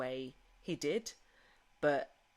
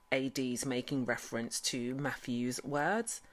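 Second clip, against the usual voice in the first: ordinary talk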